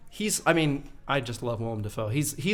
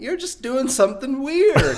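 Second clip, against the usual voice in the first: laughing mock aside; 12 semitones higher, 9 dB louder than usual. Mocking Gary Oldman's accent.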